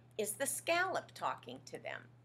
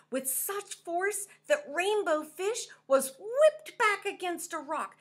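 dramatic storytelling; 10 semitones higher, 7 dB louder than usual. A narrator's reading.

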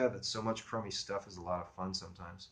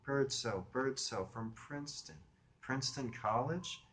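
high, softer, slow female voice; pondering a name; 5 semitones higher, 4 dB quieter, slower than usual. Acted. Woman tries to place caller's friend, high and musing. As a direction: turn the name over slowly, questioning. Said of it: "running the name over in her mind".

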